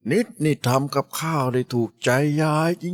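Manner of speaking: put-on slow, high, delighted old woman; grandma praising the girl's cooking, delighted. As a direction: gush over the food like a pleased granny. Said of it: "ออกปากชมนิดอย่างไม่หยุดปาก".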